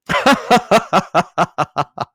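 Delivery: loud, high laughing crowd; big party guffaw; lusty roaring laugh. A narrator's reading. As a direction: roar with laughter. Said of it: "roared out lustily".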